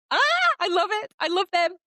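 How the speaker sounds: high-pitched delighted squeal, easing as it repeats; excited squeal. Real, not acted. Fan gushing over the couple in the show, squealing with delight. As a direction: gush loudly, starting way up high.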